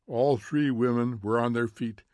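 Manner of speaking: ordinary delivery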